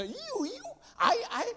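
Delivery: high, exaggerated English pronouns; mock village youth. Teacher mimicking how villagers talk, high mocking voice. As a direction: squeal the borrowed words in parody. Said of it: "di desa ngomongnya".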